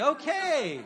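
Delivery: high laughing okay; amused laughing aside. Real, not acted. Laughing at his own joke.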